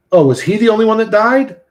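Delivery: surprised. Real, not acted. Surprised at chat news.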